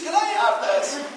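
put-on high child voice asking; child on knees begging for a treat, high; begging kid. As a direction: plead like a kid on his knees. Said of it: "They're on their knees".

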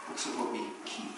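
preacher drops to a whisper to drive the point home; hushed echo. Shown as a demonstration. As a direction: let it sink in quietly. Hushed echo of key phrase.

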